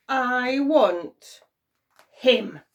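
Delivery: loud, heavy, slow claim; loud villainous claim; menacing demand. A narrator's reading. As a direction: hammer out the demand. Imp claims baby.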